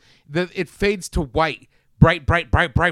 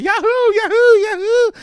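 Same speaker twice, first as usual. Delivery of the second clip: fast words rising to a high, loud yahoo yell; mock screaming yahoo; 10 dB louder than usual. Host imitating the young monster's yahoo scream. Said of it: "screaming 'cause he's over the".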